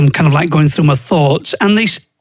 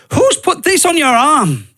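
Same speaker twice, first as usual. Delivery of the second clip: doctor's sharp question; 9 semitones higher than usual. Retold or imitated. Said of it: "she goes".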